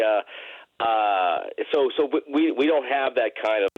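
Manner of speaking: ordinary talk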